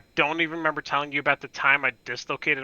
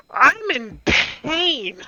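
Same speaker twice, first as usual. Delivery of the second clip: very high, very slow pained whine; mock whine; 11 semitones higher, 4 dB louder, much slower than usual. Brother whining back at his sister, hammed-up pain. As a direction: stretch it into a hammed-up wail.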